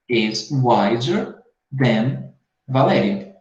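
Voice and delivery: very slow, each word set apart for the class; slow teacher model. Shown as a demonstration. Teacher models the full comparison sentence, very slowly for the class. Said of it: "Do que Valéria".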